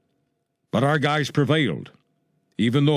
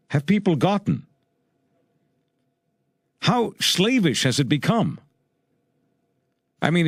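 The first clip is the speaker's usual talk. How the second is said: high-pitched, incredulous rhetorical questions; indignant challenge; 6 semitones higher than usual. Real, not acted. Radio host challenges people too timid to explain the anthem, indignant. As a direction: fire off indignant questions, pitch raised, pausing between them.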